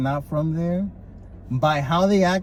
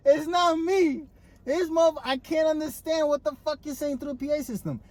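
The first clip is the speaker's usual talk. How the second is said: exasperated quote; 10 semitones higher than usual. Retold or imitated. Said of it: "I'm like".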